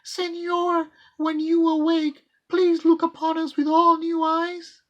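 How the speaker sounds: put-on high little child's voice; child murmuring. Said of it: "murmured in his ear".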